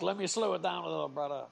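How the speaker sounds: hint of an impression, quick start easing off; lightly voiced, badly faked wrestler impression; half-hearted bad impression of a laid-back wrestler; Tito Santana. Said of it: "bad impression, I think".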